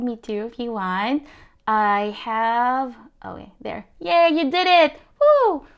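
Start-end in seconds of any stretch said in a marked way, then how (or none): 4.0-5.7 much higher, louder whoop; excited cheer. Real, not acted. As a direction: celebrate the viewers' success. Cheers on the viewers.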